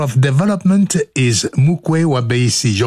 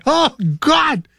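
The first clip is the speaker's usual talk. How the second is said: mock panic; 11 semitones higher than usual. Acting panic in fire.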